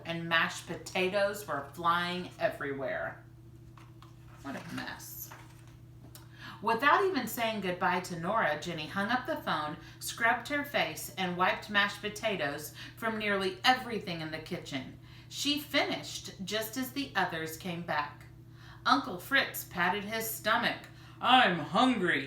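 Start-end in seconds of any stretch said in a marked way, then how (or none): 4.4-5.3 breathless whisper; whispered aside. A narrator's reading. Aside after potato mess. 21.2-22.3 slow, louder uncle's voice; a hungry uncle patting his stomach. Acted. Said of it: "he said".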